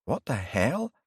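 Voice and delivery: jaw-dropping surprise, lightly voiced; surprised. A narrator's reading. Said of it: "her jaw dropped in surprise".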